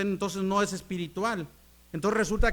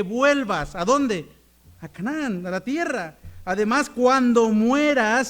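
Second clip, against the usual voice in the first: full-force preaching; 3 semitones higher, 6 dB louder than usual. Acted. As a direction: shout the point to the church.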